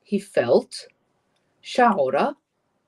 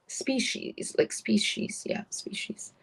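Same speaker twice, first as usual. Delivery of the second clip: whispered aside; 8 dB quieter, slower than usual. Real, not acted. Unvoiced aside while searching word.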